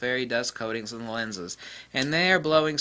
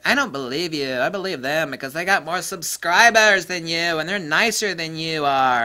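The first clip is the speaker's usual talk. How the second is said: mock doubter; 5 semitones higher, 6 dB louder than usual. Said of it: "Someone's like".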